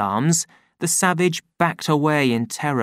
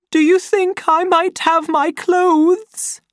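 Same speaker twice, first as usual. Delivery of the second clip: very high, slow, loud asking; timid request; 5 dB louder, slower than usual. A narrator's reading. Lenina asking John through the door, frightened. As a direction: ask for your clothes nervously.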